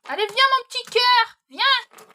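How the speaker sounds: high, loud, sweet call to the dog; loud coaxing call. Real, not acted. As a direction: coax the dog back, calling out tenderly. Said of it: "Je suis désolée". (appel fort et tendre au chien)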